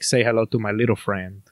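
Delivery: low, bad fake Spanish accent; Al Pacino. Quoting a gangster film, fake Spanish accent. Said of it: "in his bad, fake Spanish accent".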